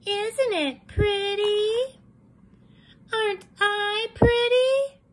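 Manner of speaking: high, slow, sing-song; vain girl voice. Acted. Red admiring her cape, high and sing-song. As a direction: preen like a vain little girl. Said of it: "Red waved her cape".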